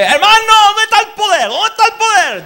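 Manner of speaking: high, very loud demanding shout; shouted from below. Retold or imitated. Congregation shouts at the preacher, high and loud. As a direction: yell it up at the preacher. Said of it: "De abajo me gritaban".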